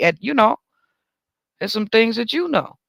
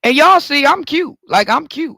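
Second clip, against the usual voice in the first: pitched way up, loud and proud of herself; playful bragging; 8 semitones higher, 5 dB louder than usual. Real, not acted. Telling the listeners she's cute, sassy brag. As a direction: show off, sassy and pleased. Bragging about her looks.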